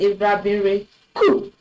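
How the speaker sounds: recoup said with a hard K, reku; wrong hard K. Shown as a demonstration. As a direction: say recoup with a K sound. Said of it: "without accent sedile".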